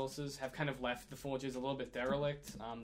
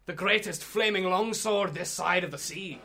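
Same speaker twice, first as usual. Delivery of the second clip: higher, louder grand sales pitch; dwarf salesman; 5 semitones higher, 8 dB louder than usual. Acted. Shopkeeper pitching a flaming longsword, grand dwarf voice. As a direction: present the prize ware with relish. Shopkeeper shows off legendary sword.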